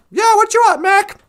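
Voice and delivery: loud, high shouted reply; shouting back. Acted. Construction worker answers over the din, shouting back. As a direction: holler back over a jackhammer.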